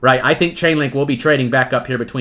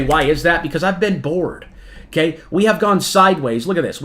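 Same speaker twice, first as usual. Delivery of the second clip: ordinary talk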